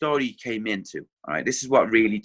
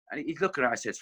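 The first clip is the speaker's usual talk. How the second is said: ordinary talk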